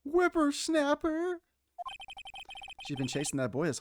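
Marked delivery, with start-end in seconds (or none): 0.0-1.4 put-on high, slow old woman stretching out whippersnapper; cranky old lady. Old lady scolding Phoenix with a high, slow whippersnapper. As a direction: screech whippersnapper like a cranky granny.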